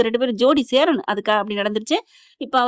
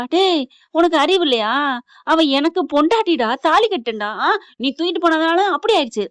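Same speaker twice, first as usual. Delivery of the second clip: raised, louder indignant outburst; angry berating; 4 semitones higher, 4 dB louder than usual. Acted. Hero claims his wife while fighting kidnapper, angry berating. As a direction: lay into the rival, indignant and forceful.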